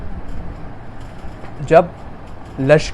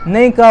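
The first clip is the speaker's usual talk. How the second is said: sharp high stress on کہا, louder; emphatic repeat; 7 semitones higher, 8 dB louder than usual. Acted. Preacher insists to mourners, high emphatic stress. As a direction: hammer the denial نہیں کہا.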